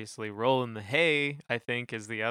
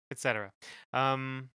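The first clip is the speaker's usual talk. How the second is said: normal talking voice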